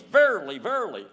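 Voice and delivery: loud, high, emphatic repeat; forceful preacher's declaration; emphatic proclaiming. Acted. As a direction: punch it hard, first one loudest. Emphatic repeated words to Nicodemus.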